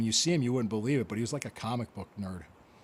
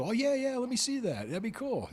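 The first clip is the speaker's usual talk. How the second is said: eager rookie; 11 semitones higher than usual. Retold or imitated. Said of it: "I'd be excited too".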